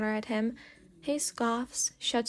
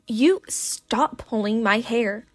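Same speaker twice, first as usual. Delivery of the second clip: loud, slow protest yell; yelling back; 8 dB louder, slower than usual. A narrator's reading. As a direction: bellow it while your hair is being pulled. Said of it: "he yells back".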